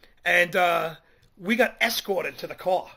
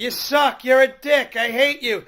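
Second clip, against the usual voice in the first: yelling; 5 semitones higher, 5 dB louder, slower than usual. Retold or imitated. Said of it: "she just started cursing and yelling".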